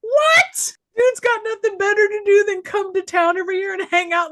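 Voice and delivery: real high, loud, laughing disbelief; incredulous outburst. Real, not acted. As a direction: blurt it out fast and high in amused disbelief.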